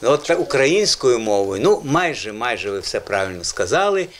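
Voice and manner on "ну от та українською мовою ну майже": usual speaking voice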